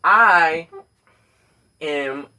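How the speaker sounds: usual speaking voice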